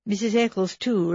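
ordinary delivery

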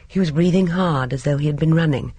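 ordinary delivery, nothing marked

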